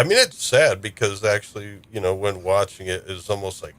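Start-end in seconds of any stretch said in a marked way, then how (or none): none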